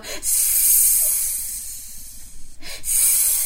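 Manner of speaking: soft unvoiced hiss; snake hiss. Shown as a demonstration. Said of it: "can we hiss like a snake".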